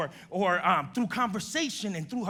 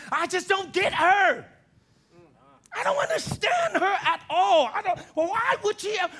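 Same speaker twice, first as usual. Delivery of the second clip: high, loud, baffled complaint; raised voice, hammed-up incomprehension; mock exasperation; 10 semitones higher, 7 dB louder than usual. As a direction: act out a spouse at wits' end.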